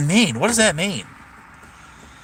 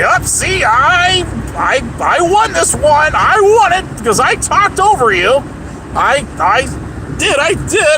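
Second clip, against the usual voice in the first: much higher, whiny voice of a blowhard who talks over you; mocking the internet loudmouth; 11 semitones higher than usual. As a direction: sneer it out like a know-it-all who just won by talking over you. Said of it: "possible way that you can say".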